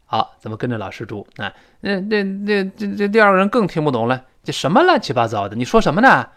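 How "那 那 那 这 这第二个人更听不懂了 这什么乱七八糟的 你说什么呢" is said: acted bafflement.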